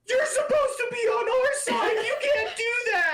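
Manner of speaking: very high voice through laughter; laughing squeaky protest. Retold or imitated. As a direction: squeal betrayed through giggles. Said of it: "people that were".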